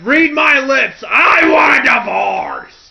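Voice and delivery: loud, high, slow shout demanding a divorce; Vince McMahon shouting. Retold or imitated. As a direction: bellow it like a furious wrestling boss. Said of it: "just the way he says it".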